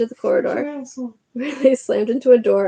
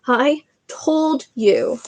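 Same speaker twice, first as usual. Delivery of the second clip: slow, drawn-out repeat, a bit higher; slow breathless repeat; 4 semitones higher, much slower than usual. Acted. Hermione repeating herself to Harry, slow and spent. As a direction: lean on told, slow and spent. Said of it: "Hermione gasped".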